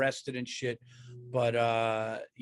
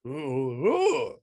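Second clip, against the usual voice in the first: zombie moan; 4 dB louder than usual. Acted. Zombie hanging out in the front yard, mock moan.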